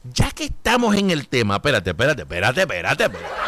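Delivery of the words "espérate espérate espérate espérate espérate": dropping low. Real, not acted. Stopping himself mid-thought.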